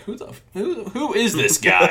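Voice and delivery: high-pitched, sputtering disbelief; losing his marbles. Retold or imitated. Podcaster voicing a character baffled by the intruder, losing his marbles. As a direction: imitate someone starting to lose it. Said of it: "he's losing his marbles".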